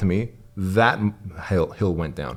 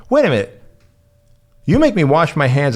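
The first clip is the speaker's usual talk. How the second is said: high-pitched, louder mock protest, imitating his child self; indignant outburst; 7 semitones higher, 4 dB louder than usual. Boy protesting to the grandmas, high indignant mock outrage. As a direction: wait a minute rises high in outrage, then presses on. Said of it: "And I'm like".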